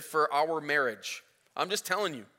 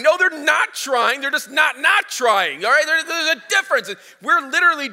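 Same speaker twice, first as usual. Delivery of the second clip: higher and louder, stressing not; mock argument shout; 9 semitones higher, 8 dB louder than usual. Husband shouting back at his wife in the car, loud and insistent. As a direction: replay the shouting match, hitting NOT hard. Retold screaming match in the car.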